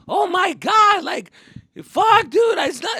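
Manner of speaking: high, loud frantic yelp; panicked protest. Retold or imitated. Kid protesting to his angry dad, frantic shout. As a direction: squeal it out like a cornered kid.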